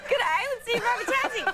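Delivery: high, laughing Aussie catcall; mock Australian accent. Guest joining host's slang joke, mock Australian accent. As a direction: hail them in a playful high Australian voice.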